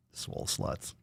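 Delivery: voiceless hushed aside; whisper. Real, not acted. Streamer names the fans, whispering. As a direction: whisper it under your breath.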